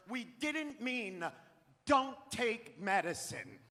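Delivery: each word slow and deliberate; slow emphatic clarification. Real, not acted. Comic clarifying to the audience, slow and deliberate. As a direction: spell it out slowly for the crowd. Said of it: "if it's not clear to you out there".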